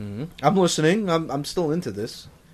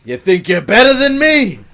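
angry yell; 8 semitones higher, 10 dB louder than usual. Pretend. Voicing angry duck owner.

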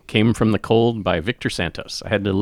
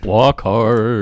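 Dewey Cox impression. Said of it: "doing my Dewey Cox impression".

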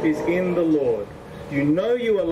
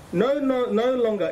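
ordinary delivery

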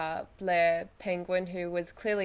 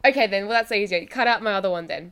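normal talking voice

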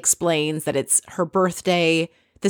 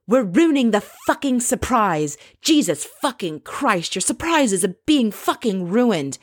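mock tirade; 3 semitones higher than usual. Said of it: "goes on this tirade to the poor driver".

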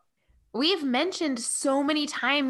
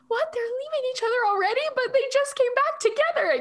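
disbelief; 13 semitones higher than usual. Retold or imitated. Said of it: "So it's like".

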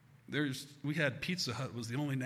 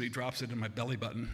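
usual speaking voice

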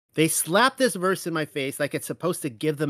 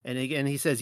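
ordinary talk

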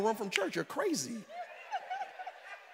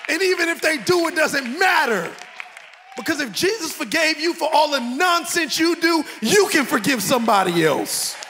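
full-force preaching; 4 semitones higher, 9 dB louder than usual. Acted. Pastor rebuking the congregation, preaching shout. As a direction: preach it hard to the crowd.

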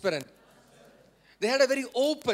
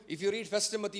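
ordinary delivery

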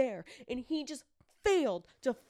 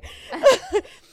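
high burst of laughter; 14 dB louder than usual. Real, not acted. Laughing at own story.

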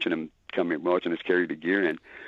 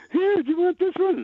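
high, sugary mock girl voice; eager helper; 4 dB louder than usual. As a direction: play it as a sweet overeager helper. Girls helping blind drummer.

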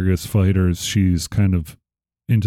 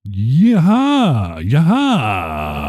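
karate yaha; 4 semitones higher, much slower than usual. Shown as a demonstration. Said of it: "we made a new, uh, exclamation".

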